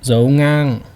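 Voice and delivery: ngang held level, loud; flat tone. Shown as a demonstration. Teacher naming the Vietnamese tones, ngang held flat. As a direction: say dâu ngang with no tone movement on ngang. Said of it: "flat tone or no tone".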